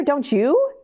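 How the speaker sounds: ordinary delivery, nothing marked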